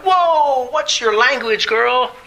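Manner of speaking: high drawn-out "whoa", easing down; playfully scandalized dad reaction; mock shock. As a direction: pull them up short in mock alarm. Father's mock-shocked reply to daughter.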